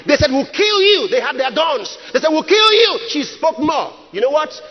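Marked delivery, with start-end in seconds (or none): 0.3-1.1 high-pitched acted death threat; mock threat. Armed kidnappers threatening their captive, mock threat. 2.3-3.0 high-pitched acted death threat; mock threat. Armed kidnappers threatening their captive, mock threat.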